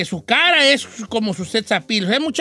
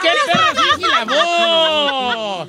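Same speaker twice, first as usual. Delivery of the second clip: higher, long drawn-out incredulous cry; mock outrage; 5 semitones higher, much slower than usual. Real, not acted. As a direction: stretch it out, scandalised by the fake voice. Burla incrédula de la voz fingida. (reclamo burlón, agudo y muy alargado)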